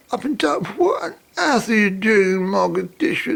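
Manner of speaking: high, cracking voice of disbelief; retelling his own shocked cry, pitched high; shocked, near tears. Retold or imitated. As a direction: blurt it out, voice breaking with shock. Shocked at finding her there.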